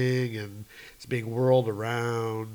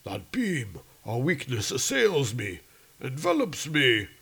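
Odin's voice; 2 semitones higher than usual. Acted. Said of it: "And he's like".

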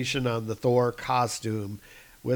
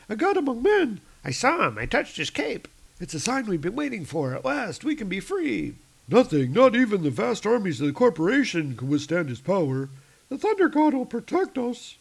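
high gossiping workers; 9 semitones higher than usual. Acted. Factory gossip about Thor.

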